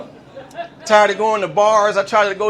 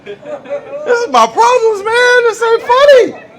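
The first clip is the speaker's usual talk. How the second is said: very high, slow tearful whine; hammed-up crying protest, building louder; mock wailing; 14 semitones higher, 4 dB louder, slower than usual. Comic pleading with the laughing crowd, mock wailing.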